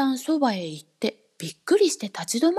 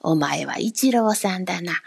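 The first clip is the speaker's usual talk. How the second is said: lower, sly grinning voice; a strange little man smirking at the boy; grinning odd man; 6 semitones lower than usual. A narrator's reading. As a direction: speak low with a crooked grin. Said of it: "口を曲げてニヤッと笑って言いました".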